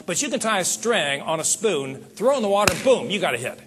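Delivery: ordinary delivery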